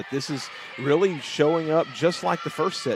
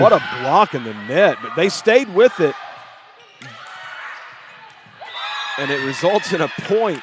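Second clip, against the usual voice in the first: excited; 4 semitones higher, 8 dB louder than usual. Real, not acted. Exciting rally, play-by-play call.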